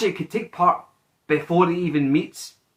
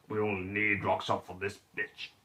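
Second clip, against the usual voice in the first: low, soft character voice, a touch of menace; terse reply; 7 semitones lower, 11 dB quieter than usual. Acted. Hunter answering Butcher about rock salt, low soft voice. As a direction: drop low and quiet for the reply. Acted reply in the retold scene.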